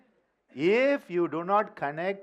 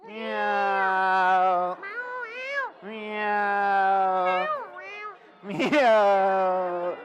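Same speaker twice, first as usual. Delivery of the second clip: long loud meowing cat; 5 dB louder, much slower than usual. Pretend. Leading the audience in noise-making, loud drawn-out cat meow. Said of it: "Yeah, cat".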